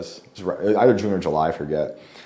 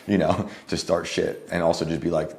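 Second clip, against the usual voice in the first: ordinary delivery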